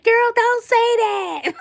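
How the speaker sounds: high, slow mock scold through a laugh; friend's scolding voice. Friend telling her off, high laughing scold.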